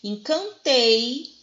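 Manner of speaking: teacher's drawn-out TEI in "Encantei"; stress on last syllable. Shown as a demonstration. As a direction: stretch out "Encantei", landing on TEI. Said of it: "sílaba tônica é no tei".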